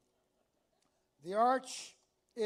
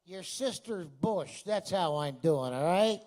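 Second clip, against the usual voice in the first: rude comeback; 3 semitones lower, 8 dB louder than usual. Retold or imitated. New Yorker's rude reply.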